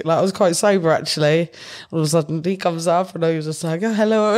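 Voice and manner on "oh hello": put-on light Italian accent on a greeting; a hint of an Italian accent. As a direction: greet her the way an Italian man would. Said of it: "in his Italian accent".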